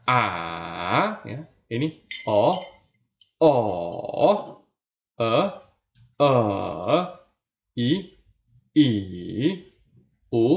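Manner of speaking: long dipping vowel drill; third tone vowels lengthened. Shown as a demonstration. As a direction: stretch each vowel in a dip and rise. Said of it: "ini dipanjangin saja".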